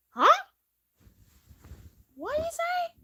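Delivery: sudden high, loud huh; surprised huh. Acted. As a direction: caught off guard, blurt it out. Sister surprised by remark.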